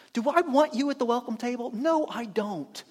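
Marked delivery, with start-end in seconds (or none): none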